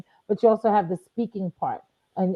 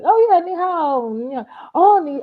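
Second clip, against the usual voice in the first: much higher and louder, joyful; excited greeting; 8 semitones higher, 6 dB louder than usual. Retold or imitated. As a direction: greet tourists with delight. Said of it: "I was like".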